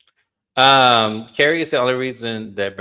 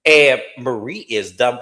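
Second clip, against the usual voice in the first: ordinary talk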